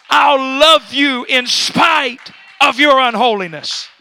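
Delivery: loud, impassioned pulpit proclamation; full-force preaching. Real, not acted. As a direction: build to a slow, booming scriptural climax.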